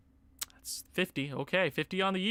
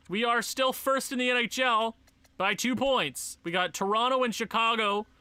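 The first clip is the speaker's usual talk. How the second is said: ordinary talk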